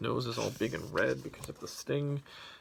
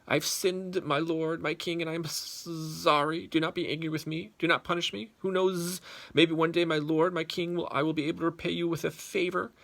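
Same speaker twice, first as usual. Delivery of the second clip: bolder bee; 6 semitones higher, 5 dB louder than usual. Acted. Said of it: "gathered more courage and continued".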